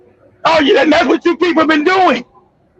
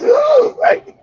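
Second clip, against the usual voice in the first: mock ooh; 11 semitones higher than usual. Mocking reaction to the quote.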